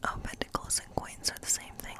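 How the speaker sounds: usual speaking voice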